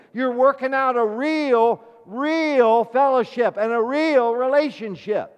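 loud, high, forceful sermon delivery; impassioned preaching. Real, not acted. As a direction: drive the point home to the congregation.

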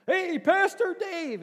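slow, high, loud hail across the market; cheerful hammed-up call. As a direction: shout to catch someone's eye. Calling out in marketplace.

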